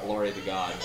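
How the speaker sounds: usual speaking voice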